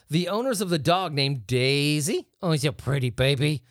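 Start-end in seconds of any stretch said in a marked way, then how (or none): 2.4-3.6 a hint of baby talk; cooing owner. Podcaster lightly voicing the owners cooing over their Saint Bernard. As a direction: coo at the dog like a fond owner.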